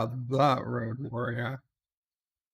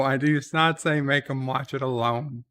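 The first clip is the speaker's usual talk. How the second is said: usual speaking voice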